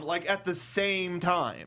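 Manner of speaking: normal talking voice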